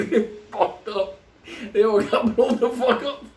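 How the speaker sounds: high, cracking up mid-story; laughing at tragedy. Real, not acted. Comic telling of a lightning strike, laughing helplessly. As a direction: unable to hold back laughter, pitch climbing. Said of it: "I laugh at the tragedy".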